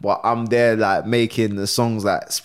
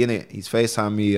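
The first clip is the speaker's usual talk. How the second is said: ordinary talk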